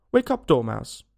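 loud wake-up call. A narrator's reading. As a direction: call sharply to rouse a sleeper. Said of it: "they both cried".

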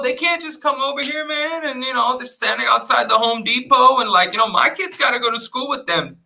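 high-pitched imitation of an indignant neighbor; going in hard. Neighbors complaining about immigrants at a cookout, mock indignant. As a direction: rant like a fed-up neighbor, imitated. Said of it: "straight up going in on".

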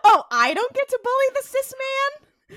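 high-pitched mock protest; mock indignation. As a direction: act outraged at being denied your fun. Mock protest over podcast bullying.

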